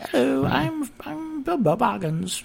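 squeaky-high playful hobbit intro; Bilbo voice. Pretend. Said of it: "I'll do all the voices".

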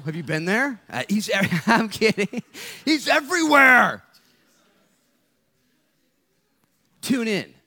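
rising preacher's yell; preaching shout. Real, not acted. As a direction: build to a joyful preacher's cry.

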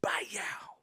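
whispered slap noise; smack sound. Retold or imitated. Mom's slap imitated with a whispered bah-yow. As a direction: mimic the slap sharply.

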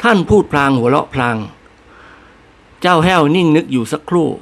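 ordinary delivery

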